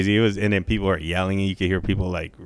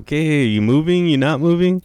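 impatient mock; 7 semitones higher, 5 dB louder than usual.

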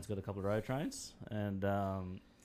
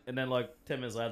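ordinary talk, nothing marked

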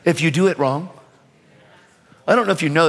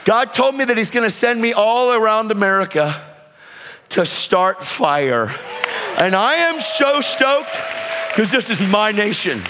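fiery preaching; 4 semitones higher than usual. Acted. Preacher shouting again.

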